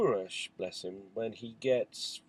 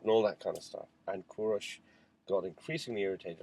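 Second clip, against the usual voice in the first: ordinary talk, nothing marked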